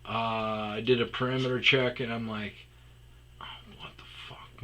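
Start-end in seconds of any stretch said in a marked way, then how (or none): 3.4-4.6 breathy whispered shock; startled whisper. Retold or imitated. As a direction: hiss it under your breath on seeing him. Discovering man behind bush.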